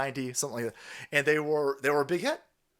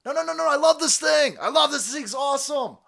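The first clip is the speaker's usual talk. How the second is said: high, loud pleading voice; mock begging; 10 semitones higher, 5 dB louder than usual. Lease drivers pleading to keep their cars, high and begging. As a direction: beg like a desperate owner, pitched way up. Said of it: "People were begging".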